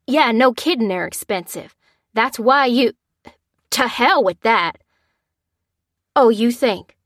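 irate caller. A narrator's reading. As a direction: snap back at the insurer. Father argues with insurer.

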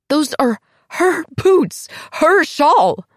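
high, slow, frantic accusing; shrill accusation. Acted. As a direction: point at the boots and shout. Accusing Diana, rising panic.

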